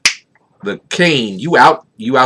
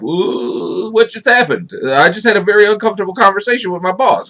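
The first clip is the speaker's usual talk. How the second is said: high, rushed and shaken; rattled; 7 semitones higher than usual. Retold or imitated. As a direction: blurt it out, rattled. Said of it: "It's more like".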